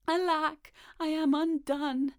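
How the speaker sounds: high, loud, slow lament; despairing cry. A narrator's reading. Tailor of Gloucester who has lost his twist, despairing lament. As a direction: wail it out theatrically.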